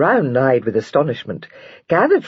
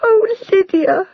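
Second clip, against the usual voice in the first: much higher and louder, slow, sobbing; bursting into tears; 4 dB louder, slower than usual. A narrator's reading. Jenny bursts into tears greeting Lydia. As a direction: break down crying with joy. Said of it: "bursting into tears".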